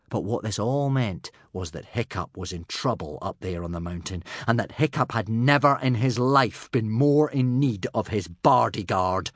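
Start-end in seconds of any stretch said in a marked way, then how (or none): none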